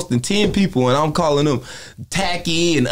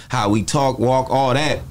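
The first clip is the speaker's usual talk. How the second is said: ordinary talk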